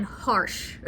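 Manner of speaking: ordinary talk, nothing marked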